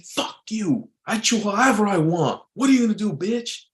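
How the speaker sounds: angry, high-pitched comeback. Shown as a demonstration. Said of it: "That's raising your, your voice".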